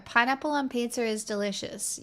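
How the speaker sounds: usual speaking voice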